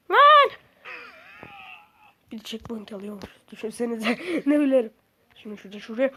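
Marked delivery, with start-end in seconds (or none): 0.1-0.5 high loud yell; sudden real yell of alarm; shout. Real, not acted. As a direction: blurt it out in shock. Reacting to fall in game.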